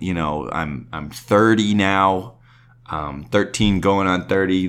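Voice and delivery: ordinary talk, nothing marked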